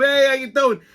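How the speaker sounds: high, hammed-up hello to the crowd; showy greeting. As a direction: greet four fans like an inductee. Waving like a Hall of Famer.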